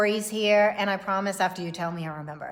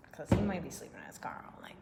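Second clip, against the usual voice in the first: whisper; 4 semitones lower, 9 dB quieter, faster than usual. Acted. Whispered joke aside.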